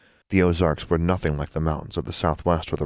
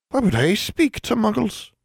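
tiny elf. Acted. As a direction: speak like a small wrinkled creature. Elf replies.

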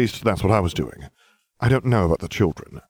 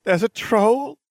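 put-on a much higher, frightened girl's question; shaky; slower than usual. A narrator's reading. Hermione hiding in the stall, frightened and shaky. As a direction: ask tearfully from hiding. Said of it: "a shaky voice asked".

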